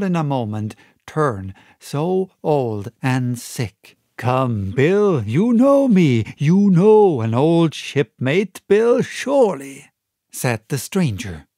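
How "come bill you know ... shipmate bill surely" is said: put-on loud wheedling old shipmate; pleading pirate.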